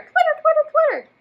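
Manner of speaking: very high chirpy twitter; bird twitter. Shown as a demonstration. Teacher showing kids a bird twitter. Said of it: "And they twitter".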